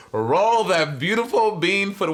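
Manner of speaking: mock commercial announcer; quoted catchphrase. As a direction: tease with a grandly stretched catchphrase. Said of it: "as you love to say".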